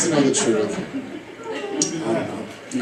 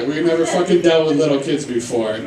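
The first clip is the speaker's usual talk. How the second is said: normal talking voice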